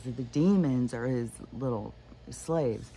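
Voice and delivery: ordinary talk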